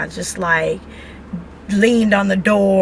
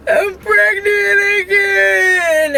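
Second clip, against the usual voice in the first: much higher, slow, tearful wail; crying; 11 semitones higher, 3 dB louder, much slower than usual. Retold or imitated. Wife reliving telling her husband the news, crying. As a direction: sob it out like you can't believe it. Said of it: "like, crying".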